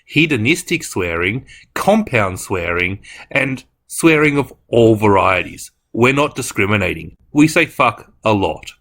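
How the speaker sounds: loud, hammed-up announcer warning; mock warning announcement. Opening the video with a loud mock swearing warning. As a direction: boom it out like a public safety notice.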